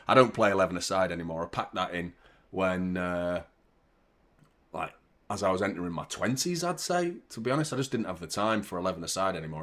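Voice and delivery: ordinary talk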